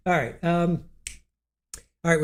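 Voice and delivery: usual speaking voice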